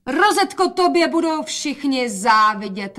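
high, loud admiring call. A narrator's reading. Mother praises daughter for all to hear, calling out. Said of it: "volala matka".

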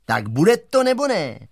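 demanding call, rising high; voice from above. A narrator's reading. Giant demands an answer from the teacher, calling down. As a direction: call down an impatient ultimatum. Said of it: "se zhora ozvalo".

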